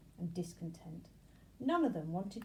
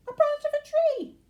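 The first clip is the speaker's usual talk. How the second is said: loud, much higher cry; cried out; 17 dB louder than usual. A narrator's reading. Fifth bee crying its idea, loud and high. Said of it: "cried the fifth".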